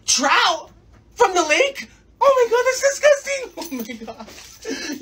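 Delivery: high, hammed-up wail of revulsion; horrified disgust. Daughter hearing dinner is lake trout, wailing disgust. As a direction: build from appalled questions to a drawn-out whine.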